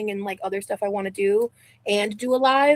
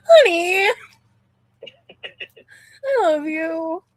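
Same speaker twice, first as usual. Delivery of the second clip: mock-weepy gushing; 9 semitones higher, 5 dB louder, slower than usual. Gushing at the couple on screen.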